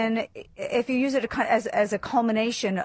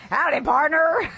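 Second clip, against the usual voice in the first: mock cowboy drawl; 6 semitones higher, 4 dB louder than usual. Mocking cowboy greeting.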